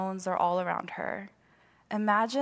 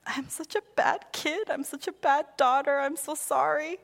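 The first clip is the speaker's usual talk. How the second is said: remorseful pleading; 7 semitones higher than usual. Retold or imitated. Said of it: "just like".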